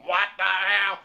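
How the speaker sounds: mock Donald Duck throat-device voice; electrolarynx imitation. Old man with a throat hole answers door, buzzing imitation. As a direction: imitate a man talking through a throat buzzer. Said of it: "Donald Duck talking apparatus".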